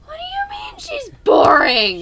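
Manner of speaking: very high, loud incredulous question; scandalised squawk; outraged disbelief. Real, not acted. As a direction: demand it in a high-pitched outcry. Outraged at partner's opinion.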